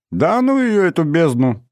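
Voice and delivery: high-pitched, vigorous brush-off; energetic dismissal. A narrator's reading. Boy reassures embarrassed Inna, energetic dismissal. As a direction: wave it off with energy. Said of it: "энергично отмахнулся я".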